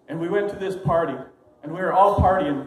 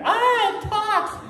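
high, loud scream of fright; shrieking girl, high and loud; 3 dB louder, much slower than usual. Acted. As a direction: shriek like she's seen a ghost. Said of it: "She screamed".

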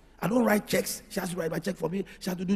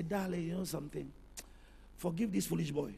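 much softer, lower, gentle pleading; tender apology; 3 semitones lower, 14 dB quieter than usual. Retold or imitated. Husband softly pleading to his fiancée. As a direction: coax her softly to forgive you.

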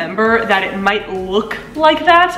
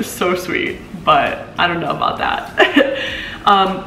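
normal talking voice

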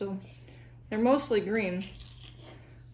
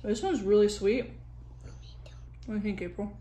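talking with food in mouth; muffled speech between bites; chewing while talking; 4 dB louder than usual. Real, not acted. Seen 3: Bites tomato at -5; closed, bulging cheeks and chewing through the speech.

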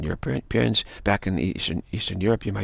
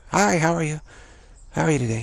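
high, bright-pitched store greeting; cheery clerk greeting; 8 semitones higher than usual. Retold or imitated. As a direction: greet a customer in a higher voice.

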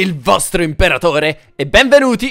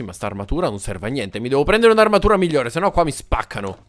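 usual speaking voice

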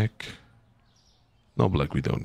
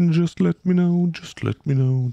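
high mock sing-song; 9 semitones higher than usual. Shown as a demonstration. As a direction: fling "Just let me know" up high in a sing-song.

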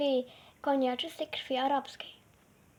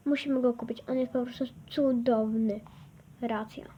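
usual speaking voice

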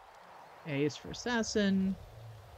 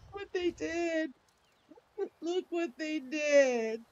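slow dismay; 8 semitones higher, much slower than usual. Real, not acted. Dismay at game change.

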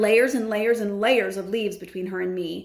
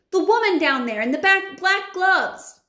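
frightened shout; 10 semitones higher, 6 dB louder than usual. A narrator's reading. Frightened boy calling from tree.

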